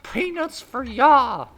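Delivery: very high, loud, slow squawk; mock flight attendant answering the game's collision; clucking flight attendant. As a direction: cluck it out like a chicken.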